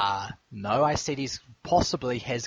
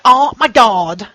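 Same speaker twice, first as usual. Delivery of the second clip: put-on much higher, louder, slow Valley Girl "Oh my God"; 10 semitones higher, 14 dB louder, slower than usual. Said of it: "Valley Girl?".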